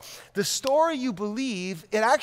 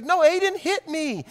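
put-on higher, tearful little-boy protest; on the verge of tears; 7 semitones higher than usual. Four-year-old insisting to dad, high and near tears. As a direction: insist like a kid about to cry. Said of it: "Alex is on the verge of tears".

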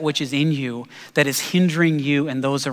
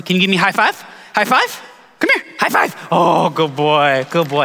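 excited dog praise; 4 dB louder than usual. Real, not acted. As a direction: cheer the dog on like a proud owner. Coaxing the dog to high-five.

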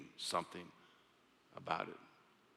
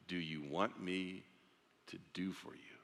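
gentle question; 4 semitones lower, 10 dB quieter than usual. Acted. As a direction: let the question land softly. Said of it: "Those are powerful words".

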